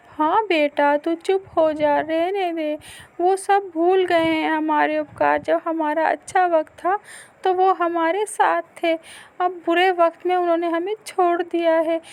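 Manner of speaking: high, loud soothing mother voice; sad consoling mother. Acted. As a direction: hush the son, sadly. (बेटे को चुप कराती दुखी माँ)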